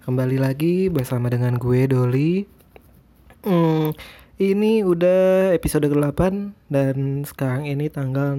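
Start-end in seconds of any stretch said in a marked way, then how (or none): none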